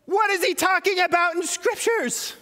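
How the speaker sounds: normal talking voice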